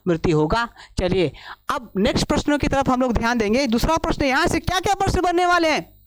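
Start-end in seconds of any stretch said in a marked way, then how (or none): none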